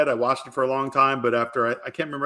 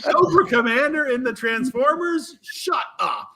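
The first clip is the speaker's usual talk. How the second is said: excited disbelief; 3 dB louder, much slower than usual. Retold or imitated. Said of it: "I was like".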